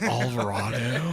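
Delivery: slow "Alvarado" with open "ah"; West Coast pronunciation. Shown as a demonstration. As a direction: stretch it out as al-va-RAH-do. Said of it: "So we say on the West Coast".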